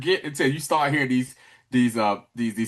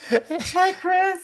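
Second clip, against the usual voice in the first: very high creepy greeting; cartoon creep impression, pitched way up; Herbert the pervert. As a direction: put on a falsetto creep voice. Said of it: "Herbert the pervert".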